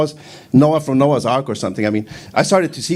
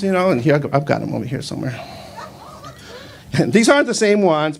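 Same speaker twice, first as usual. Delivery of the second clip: ordinary delivery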